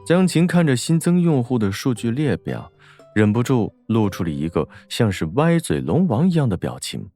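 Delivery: usual speaking voice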